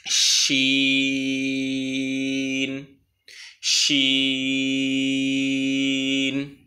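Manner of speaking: "শিন" with a very long, level "ee" closing on n; drawn-out letter name. Shown as a demonstration. Teacher showing how the letter begins and ends, long steady "শিন". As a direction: hold "শিন" on one steady note. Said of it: "শেষটা নুনের মতো হবে".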